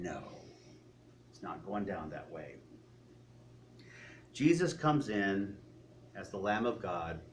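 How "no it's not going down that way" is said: grumbling religious leader. Retold or imitated. Preacher voicing cringing religious leaders, low grudging mutter. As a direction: lower and much softer, a grudging mutter of denial.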